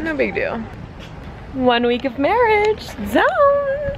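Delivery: put-on high, loud playful Italian accent. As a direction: keep the jokey Italian accent going. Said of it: "I need to cut the Italian accent".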